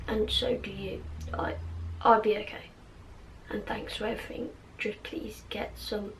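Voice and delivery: hushed, tired-sounding plea; quiet, faintly acted character voice; Ron impression. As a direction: softly urge a friend to rest, fading near a whisper. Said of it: "I got my Ron impression ready".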